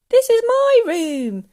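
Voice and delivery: high, loud, indignant; aggrieved child's yell; protesting boy. A narrator's reading. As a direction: object loudly, stretching the words.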